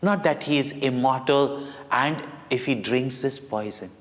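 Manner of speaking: ordinary delivery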